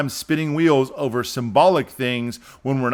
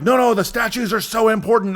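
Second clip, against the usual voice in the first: higher-pitched, pleading objection; mock insistence; 7 semitones higher than usual. Everyone defending the statues, mock insistent. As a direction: mimic people protesting earnestly.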